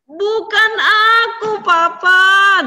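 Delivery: high, loud wailing denial; crying son. A narrator's reading. Esau wails to his father that it wasn't him. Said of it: "Dia nangis".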